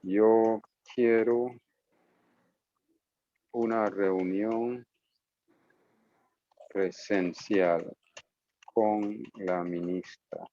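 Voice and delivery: Yo quiero said very slowly; slow and careful. Shown as a demonstration. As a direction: spell out each Spanish word slowly. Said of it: "what they would say is".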